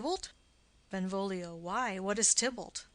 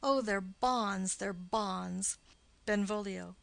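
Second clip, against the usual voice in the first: usual speaking voice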